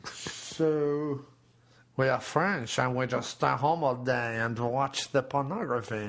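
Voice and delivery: hammed-up French accent. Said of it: "Does it sound French or not?".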